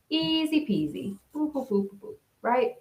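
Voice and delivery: high playful singsong. Pretend. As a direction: bounce it like clouds floating past. Playful clouds drifting by.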